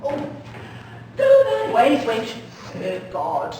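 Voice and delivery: loud dismissive shout. Acted.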